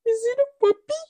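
very high mock-wondering squeal; hammed-up naive question; Teresa impression. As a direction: imitate wide-eyed amazement in a high voice. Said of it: "And she's like".

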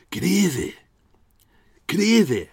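high, loud Glaswegian accent; Alexander Moyer. Shown as a demonstration. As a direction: bark it in broad Glaswegian, twice. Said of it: "Him saying".

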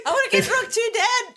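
high, whiny, laughing cat voice. Acted. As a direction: beg Dad like a needy kitten. Voicing the cat begging Dad.

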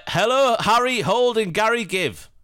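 big, high, loud sing-song hello; hammy greeting. Acted. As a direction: belt out the names like a showman. Hammed-up podcast greeting.

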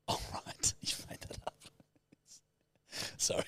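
whispered words broken by real laughter; breathless laughing. Real, not acted. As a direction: laugh through it, barely voiced. Laughing at made-up place name.

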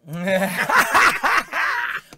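high, loud burst of laughter; real shrieking cackle. Real, not acted. Host cracking up at co-host's Spurs remark, loud laugh. Reacting to guest's joke.

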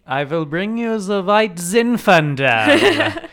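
a laughing hammed-up German accent; mock German interrogator.

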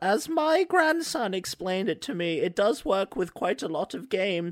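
a grandma repeating her grandson's words; man doing granny voice. Pretend. Old lady defends the price.

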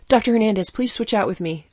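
blurted. A narrator's reading. Pediatrician blurting to Hernandez, loud. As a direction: blurt it out in a rush. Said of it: "I blurted".

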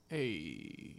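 soft low Italian mobster greeting; lightly voiced Italian mafia accent. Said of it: "you gotta do mafia voices".

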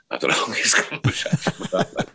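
breathy laughing through the line; real laughter over the long chat. Real, not acted. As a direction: laugh as you tell it.

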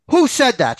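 high-pitched teacher's demand. A narrator's reading. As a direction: demand an answer, voice jumping up. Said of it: "she demanded".

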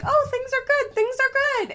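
very high, loud put-on voice; exaggerated high-pitched optimism; mock-cheery singsong. Mocking own optimism about the couple.

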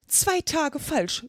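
hohes, gedehntes Seufzen; seufzend. A narrator's reading. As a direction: langsam und hoch herausseufzen. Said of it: "seufzte der Hutmacher". (langsam geseufzt)

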